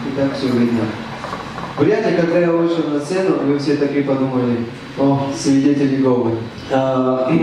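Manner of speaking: ordinary delivery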